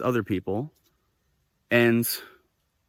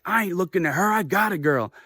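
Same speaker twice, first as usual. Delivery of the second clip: imitated defiant denial; 6 semitones higher than usual. Repeating man's defiant denial.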